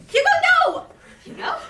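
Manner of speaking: sharp yell of alarm. Acted. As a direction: shout his name to stop him. Mother yells at son on playground.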